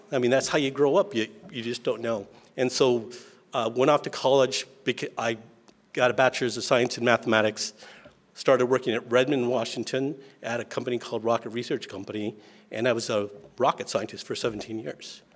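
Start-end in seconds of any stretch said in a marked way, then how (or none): none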